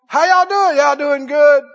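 loud, high hollered greeting across a fence; country greeting. Retold or imitated. Country neighbor hollering a greeting across the line fence. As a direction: call it out across the field.

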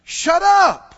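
loud, high shout of shut up; shouted rebuke. Shown as a demonstration. Preacher showing the church how to rebuke doubt, shouting. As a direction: shout them down hard. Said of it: "speak right out loud and go".

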